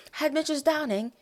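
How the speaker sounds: high-pitched name in a put-on non-American accent; lilting imitation of their accent. Shown as a demonstration. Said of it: "I can say it in the accent".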